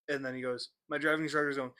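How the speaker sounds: usual speaking voice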